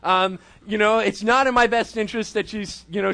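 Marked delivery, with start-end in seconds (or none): none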